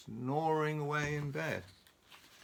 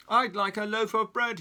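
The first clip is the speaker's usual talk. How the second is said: loud booming demand, pitched higher; 9 semitones higher, 8 dB louder than usual. Acted. Mister Noisy booms his order at Mrs Crumb, loud. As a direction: boom it across the shop. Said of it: "he boomed".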